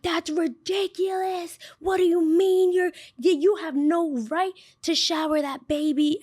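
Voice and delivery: high-pitched indignant mock yelling; shrill up, scold her like an outraged mom; imitating the angry mom. Mom scolding the daycare worker, high indignant mock yell. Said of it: "the mom starts yelling at me".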